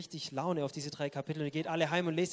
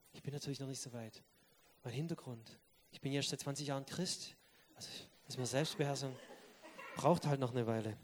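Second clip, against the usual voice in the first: soft, muttered self-excuse; mock excuse; 4 semitones lower, 7 dB quieter than usual. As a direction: mumble it away as if it's nothing. Excusing oneself mockingly.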